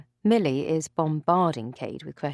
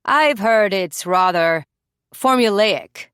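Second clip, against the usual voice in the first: slow, louder, sneering put-down; condescending; 8 dB louder, slower than usual. Acted. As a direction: look down on her, slowly. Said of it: "condescension buried like a barb".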